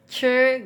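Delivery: "Sure" as Mandarin zhi, held high and flat; teacher's demo of "Sure" on one high level tone; "Sure" drawn level, tongue curled; level first-tone zhi. Shown as a demonstration. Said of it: "dia nada satu jadi datar".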